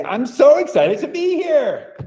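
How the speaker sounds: high, slow, beaming delivery; excited. Shown as a demonstration. Teacher models the line for partners, excited. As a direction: say it like you mean it. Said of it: "look them in the eye and say".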